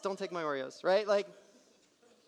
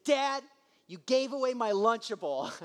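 put-on hurt kid; 5 semitones higher, 5 dB louder than usual. Son accusing dad tearfully.

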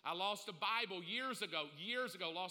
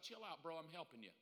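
laid-back Holy Ghost; 4 semitones lower, 10 dB quieter, faster than usual. Acted. Holy Ghost voiced casually.

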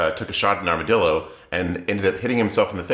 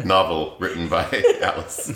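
normal talking voice

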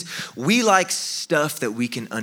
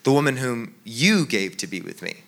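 "you" set apart and pitched up, blaming God; accusing emphasis on "you"; stress on YOU. Shown as a demonstration. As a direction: punch the word "you", then drop away. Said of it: "maybe he said it like this".

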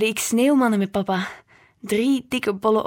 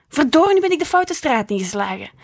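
louder, higher exasperated outburst; frustrated mother; 5 semitones higher, 6 dB louder than usual. A narrator's reading. Mother at the wheel cursing her wrong turn, exasperated. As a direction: curse the wrong turn out loud.

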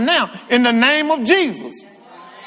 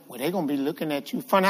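devil's whisper; 7 semitones lower, 10 dB quieter, much faster than usual. Retold or imitated. The devil's voice retold.

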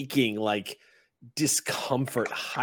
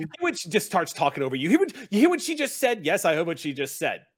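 fast, high, loud pestering; heated squabbling; 7 semitones higher, 5 dB louder, faster than usual. Acted. Goblin pesters red cap about what she said, heated and high. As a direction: talk over each other in an excited rush.

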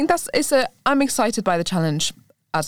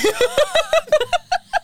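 squealing high-pitched laugh. Real, not acted. Laughing after choking on cookie.

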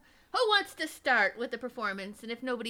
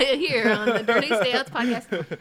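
announcer voice; 8 dB louder than usual. Pretend. Radio announcer tag line.

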